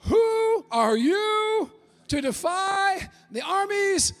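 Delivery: high, drawn-out shouting David; David's challenge. Retold or imitated. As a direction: call out the giant like young David. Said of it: "I just picture David".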